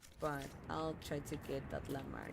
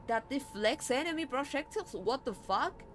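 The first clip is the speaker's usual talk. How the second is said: surprised; 7 semitones higher, 7 dB louder than usual. Real, not acted. Surprise at game text.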